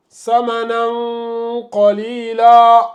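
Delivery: "samanan qalila" slowly, final n held as a long nasal hum; hidden tanwin. Shown as a demonstration. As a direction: chant "samanan qalila" drawn out, n melting into the q. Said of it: "ça donne".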